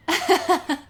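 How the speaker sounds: loud, high laugh; burst of laughter. Acted. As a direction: crack up at the idea. Laughing about levels of birding.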